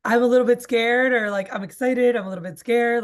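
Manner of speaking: excited admission. Retold or imitated. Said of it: "He's like, Let customers know".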